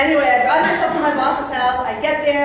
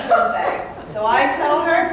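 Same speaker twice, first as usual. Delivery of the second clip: ordinary talk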